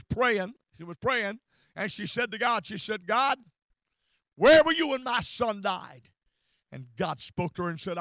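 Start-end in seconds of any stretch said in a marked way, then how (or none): 3.1-6.1 higher, louder anguished cry, retold; upset prayer. Bereaved mother praying to God, upset. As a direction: cry out to God, then let it fall. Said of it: "She was very upset".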